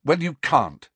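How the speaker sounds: brusque dismissal; short. A narrator's reading. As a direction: cut the offer off shortly. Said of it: "said Harry shortly".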